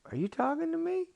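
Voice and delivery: very high, squeaky incredulous question; small boy's high-voiced question; disbelieving boy. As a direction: pitch it way up in disbelief. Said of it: "And Jordan's like".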